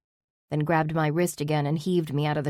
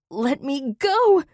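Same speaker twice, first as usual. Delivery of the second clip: slow, much higher and louder yell; furious scream; 10 semitones higher, 6 dB louder, slower than usual. A narrator's reading. Held by Zach, screaming to be let go. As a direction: fight against his arms. Struggling in his grip.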